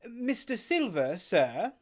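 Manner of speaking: put-on young Jim asking.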